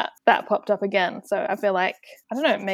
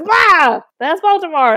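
much higher, louder delighted gasp; retelling a kid's map moment with big mock wonder; mock amazement; 8 semitones higher, 9 dB louder, slower than usual. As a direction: act thrilled to find the city. Quoting boy's delight at map.

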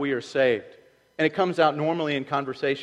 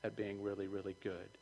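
sheepish quiet; 3 semitones lower, 10 dB quieter than usual. Retold or imitated. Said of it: "You're gonna go".

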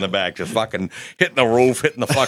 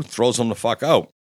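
usual speaking voice

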